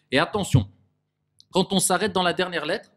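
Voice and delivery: usual speaking voice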